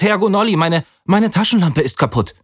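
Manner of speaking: high, stammering call for help; frightened call. A narrator's reading. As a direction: call the guide, scared. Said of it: "rief ich".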